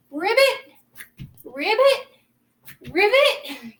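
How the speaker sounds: high rising ribbit calls; frog voice. Shown as a demonstration. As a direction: croak it out like a frog. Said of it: "Do the frog voice too".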